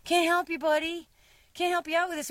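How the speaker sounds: high, teasing brush-off; mock-dismiss the old guy; dismissive retort. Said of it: "we would just be like".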